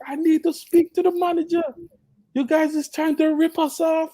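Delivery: mock-outraged, high-pitched customer complaint; rowdy mom. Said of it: "my mom's is kinda rowdy".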